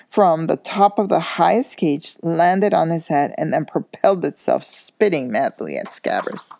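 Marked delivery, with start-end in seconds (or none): none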